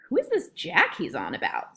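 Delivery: ordinary delivery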